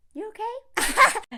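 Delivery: high, slow little question; mock concern. Copying Jessica's reply to ranters, high mock concern. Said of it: "Do as Jessica did".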